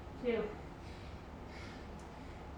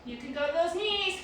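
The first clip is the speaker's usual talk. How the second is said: ordinary talk, nothing marked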